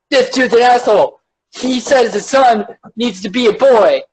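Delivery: high, tattling indignation; put-on whiny outrage, pitched well up; mock outraged mob. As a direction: play an internet accuser rallying others. Acting out the online mob.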